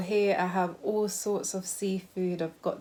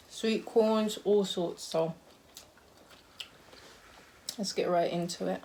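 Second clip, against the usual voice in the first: ordinary delivery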